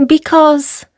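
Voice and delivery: high, tentative mouse voice; little mouse. A narrator's reading. Mouse explaining to lion, high little voice. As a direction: break off as a small high mouse. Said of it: "said the mouse".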